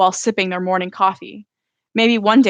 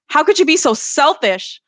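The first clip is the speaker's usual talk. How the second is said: high, loud, furious outburst; exploding in anger; 7 semitones higher, 5 dB louder than usual. A narrator's reading. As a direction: blurt it out before you can stop. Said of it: "The words explode from my mouth".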